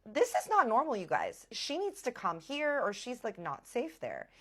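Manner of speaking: high, emphatic protest; alarmed insisting. Retold or imitated. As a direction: plead with them in acted alarm. Acted alarmed plea to others.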